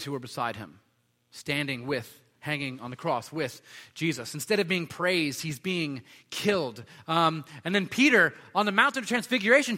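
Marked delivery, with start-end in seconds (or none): none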